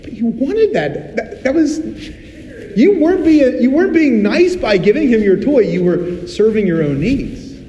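scolding dad. Retold or imitated. Father correcting the kids.

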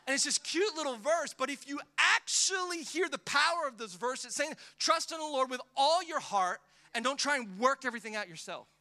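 loud, high, impassioned sermon delivery; full-force preaching. Acted. Pastor preaching to the congregation, loud and impassioned.

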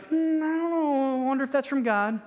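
doubtful musing. Retold or imitated. Mimicking doubtful believers.

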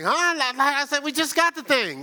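high-pitched retold surprise with a laugh; surprised huh. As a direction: squeak out a puzzled huh. Retelling surprised reaction.

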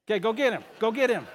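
calling out. Real, not acted. Pastor urging a child across the room to fetch Waldo, loud call.

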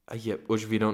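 ordinary delivery

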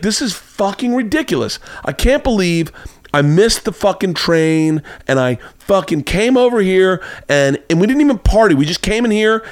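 high, indignant complaining; mock angry rant. The woman complaining at three in the morning, indignant. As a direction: whine it out like an outraged woman.

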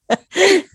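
high-pitched incredulous laugh; short laugh, high in pitch. Real, not acted. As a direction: burst out laughing at the absurdity. Laughing at priest's explanation.

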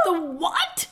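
sharp startled cry, pitched well up; a startled yelp; screamed. A narrator's reading. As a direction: blurt it out in alarm, high and loud. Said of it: "Eudora screamed".

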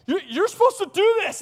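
very high, loud mock whine; guilt-tripping child. Son trying to guilt his dad, high mock yelling. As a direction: yell it like a kid demanding his due. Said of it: "trying to guilt me".

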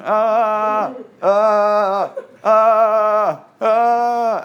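loud high held panicked wails; mock wailing. Servant's panic acted for congregation, loud wails. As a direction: cry out "ah" again and again in terror. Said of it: "a few more ahs on the end".

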